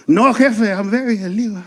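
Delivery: put-on light Mexican accent, cheerful. An immigrant worker proudly correcting the fan, Mexican accent. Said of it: "they're always there to go".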